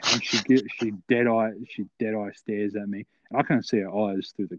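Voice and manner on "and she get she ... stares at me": ordinary delivery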